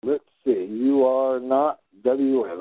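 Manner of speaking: ordinary delivery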